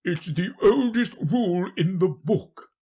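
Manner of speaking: forceful, high-pitched king; insistent king. Acted. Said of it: "said the king".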